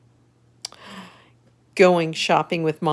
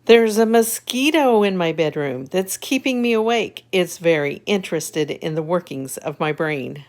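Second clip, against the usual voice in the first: lively storybook reading; reading to children; 4 dB louder than usual. A narrator's reading. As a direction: read it brightly to little kids. Reads picture book aloud.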